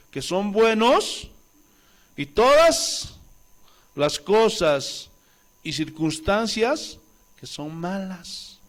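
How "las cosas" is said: ordinary talk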